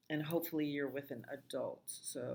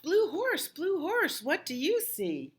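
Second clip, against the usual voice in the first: much higher and louder chanted refrain; loud sing-song refrain; 13 semitones higher, 6 dB louder than usual. Acted. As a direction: call the refrain out high to the kids.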